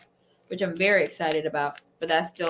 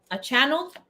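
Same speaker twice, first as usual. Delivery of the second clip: ordinary talk, nothing marked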